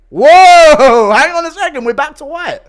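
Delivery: loud, high startled whoa; surprised outburst. Real, not acted. Streamer startled, shouting at the screen. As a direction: react like the screen suddenly changed.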